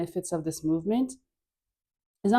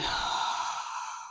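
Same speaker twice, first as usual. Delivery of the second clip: audible breath out; 9 dB quieter than usual. Shown as a demonstration. Audible exhale during breathing cue.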